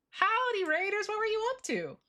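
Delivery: high chirpy greeting; perky high streamer hello; cute anime streamer. Acted. Greeting raiders again.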